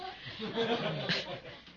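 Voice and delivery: a high, open laugh at his own joke; hearty laugh. Real, not acted. Teacher laughing at his own joke with students, high burst.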